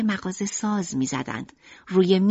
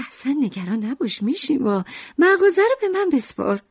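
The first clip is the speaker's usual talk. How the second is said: higher, brighter mother's voice; Lucrèce's voice; 6 semitones higher than usual. Acted. Mother tells sick Mishima not to worry, in a higher voice. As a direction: lift up into the mother's voice.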